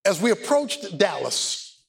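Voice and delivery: ordinary talk, nothing marked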